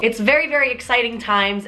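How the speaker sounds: normal talking voice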